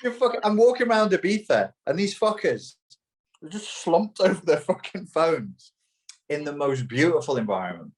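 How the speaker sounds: high, exasperated complaint; exasperated rant. Real, not acted. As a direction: vent your disgust. Ranting at phone-addicted people.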